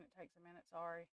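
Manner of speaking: usual speaking voice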